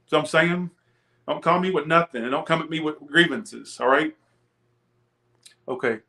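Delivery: usual speaking voice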